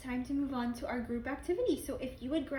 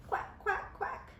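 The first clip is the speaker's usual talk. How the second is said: duck quacks; 8 semitones higher, 5 dB quieter, much slower than usual. Shown as a demonstration. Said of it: "A duck".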